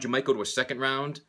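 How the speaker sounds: normal talking voice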